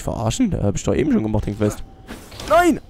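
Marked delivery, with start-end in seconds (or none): 2.5-2.8 high startled no; shocked cry. Real, not acted. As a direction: yelp it in sudden shock. Being attacked in game.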